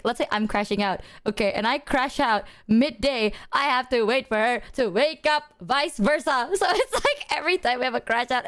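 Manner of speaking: ordinary delivery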